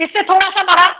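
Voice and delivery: normal talking voice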